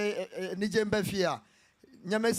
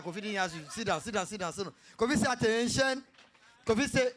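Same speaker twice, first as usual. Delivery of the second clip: normal talking voice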